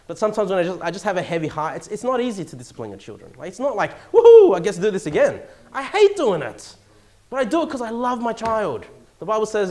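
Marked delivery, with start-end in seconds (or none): 4.1-5.4 loud, sarcastic woohoo; mock glee. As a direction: whoop with fake delight at a chore. Mock glee at punishing, denied.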